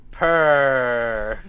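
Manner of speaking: loud drawn-out purr in a put-on voice; cat purr. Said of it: "That's my cat impression".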